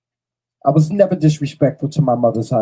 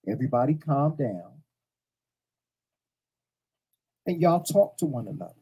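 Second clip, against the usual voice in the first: softer, gentle plea for calm; calm request; 8 dB quieter than usual. Shown as a demonstration. To the bickering family, softly calm. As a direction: ask them to settle down, no edge. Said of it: "I said it just like that".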